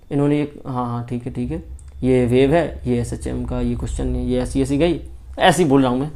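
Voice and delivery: lightly imitating a student nodding along, low and soft; a hint of a lazy student mumbling yes sir; mock passive student. Teacher mimics a student passively watching lectures, soft mumbling. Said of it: "आप lecture से अगर sir को ही ऐसे देखते रहे".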